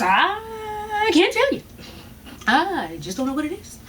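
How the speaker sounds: slow, soft, unbothered doctor mimicry; mimicking the doctor. Imitating the shrugging ER doctor, slow and soft. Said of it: "And that's how he's talking".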